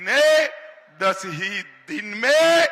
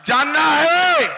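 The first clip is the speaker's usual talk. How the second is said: crowd-rousing shout; 6 semitones higher, 5 dB louder than usual. Real, not acted. Rally speaker quizzing the crowd.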